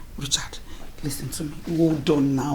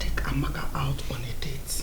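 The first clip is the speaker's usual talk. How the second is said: whispered suggestion; 4 semitones lower, 6 dB quieter than usual. Acted. Mother suggests a date.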